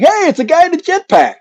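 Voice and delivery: high, far-off excited pilot call. Retold or imitated. Pilots spotting the jetpack, calling out high and excited. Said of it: "the guys in the airplanes were going".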